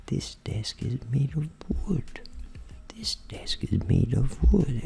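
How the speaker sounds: slow whisper with careful melody; whispered with intonation. Shown as a demonstration. Teacher demonstrating the practice technique, slow intoned whisper. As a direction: whisper it slowly, shaping each word. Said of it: "com entonação também".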